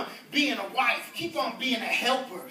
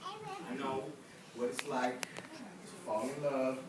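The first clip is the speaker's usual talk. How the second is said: low, soft comforting mother; tender mama; 11 semitones lower, 6 dB quieter than usual. Retold or imitated. A mother consoling her heartbroken daughter, low and tender. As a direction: hush and coax her close. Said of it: "mamas be like".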